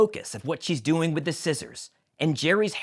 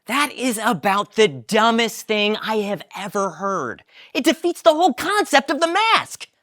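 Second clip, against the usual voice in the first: scornful Elaine; 9 semitones higher, 6 dB louder than usual. Acted. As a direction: sneer at the dumb idea. Elaine scoffs.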